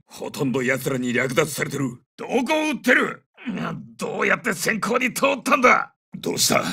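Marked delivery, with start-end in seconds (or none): 2.2-5.9 high, strained combat yell; angry shout. Acted. Soldier berates teammate over stray fire, angry high shout. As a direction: berate a teammate mid-fight.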